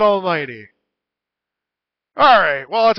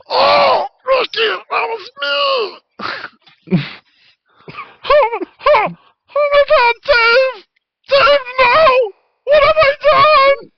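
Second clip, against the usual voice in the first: 1 stabbed gnome's dismay; 3 dB louder than usual. Acted. Stabbed gnome Dave cries out.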